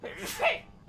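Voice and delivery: one sudden high sneeze; sneezing. Real, not acted. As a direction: let a real sneeze burst out. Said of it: "Sorry, I'm gonna sneeze".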